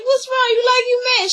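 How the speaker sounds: high, mocking voice of the aunts; mock concerned aunts. Recapping the aunts prodding Winnie, high and mocking. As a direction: put on the aunts' prying high voice.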